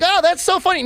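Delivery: high mocking delight; mock amused German. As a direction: play a smug onlooker chuckling at misery. Mocking Germans laughing at suffering.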